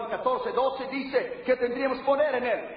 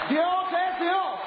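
slow shouted declaration, higher; 7 semitones higher, much slower than usual. Real, not acted. Preacher calling out to his congregation, slow and shouted. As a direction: drag out each word and call it out to the church.

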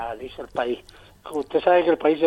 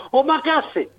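higher-pitched call out to mom; grito de niño en la cocina; 8 semitones higher, slower than usual. Retold or imitated. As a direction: imitate a kid calling to mother in dialect. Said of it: "decíamos". (niño llamando a su madre, más agudo)

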